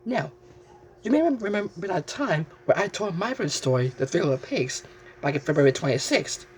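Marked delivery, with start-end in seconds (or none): none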